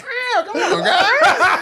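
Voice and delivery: high squeaky cartoon cat. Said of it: "Oh, like that? You went all in".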